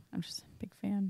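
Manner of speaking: nearly voiceless, soft whisper; whispered aside. Real, not acted. Fan admits a love of Necromunda to friends, whispered. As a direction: whisper it under the conversation.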